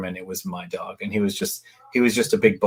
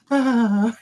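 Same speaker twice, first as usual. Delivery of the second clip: high, drawn-out mock swoon; dreamy sigh; 13 semitones higher than usual. Girls swooning over the rebel, mock dreamy sigh. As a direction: imitate a lovestruck group sigh, pitched way up. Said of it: "Lisa, and Maggie all go".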